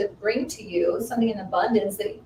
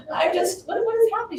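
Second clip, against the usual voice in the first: overwhelmed; 11 semitones higher than usual. Retold or imitated. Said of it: "I just started crying".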